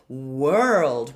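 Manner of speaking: "World" begun with a clear W glide; glided world. Shown as a demonstration. Teacher modelling the W glide in "World", careful. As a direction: start "World" from a round oo lip. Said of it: "We start with a glide".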